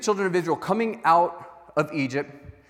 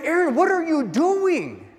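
much higher, louder, slow outraged yell; angry shout; 12 semitones higher, 6 dB louder, slower than usual. Acted. Moses confronting Aaron over the gold calf, furious shout. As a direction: bellow it like a furious leader catching him.

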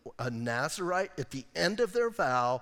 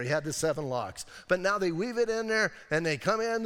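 usual speaking voice

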